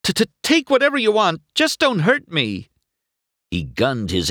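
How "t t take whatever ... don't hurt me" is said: high, stammering panicky plea; scared stutter, pitched right up; startled, frightened. A narrator's reading. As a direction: plead loudly as if about to be hurt.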